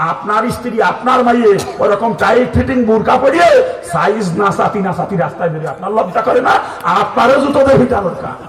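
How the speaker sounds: loud, high-pitched rebuke from the pulpit; real preacher's outburst; scolding shout. Real, not acted. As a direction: shout it at the men in reproach.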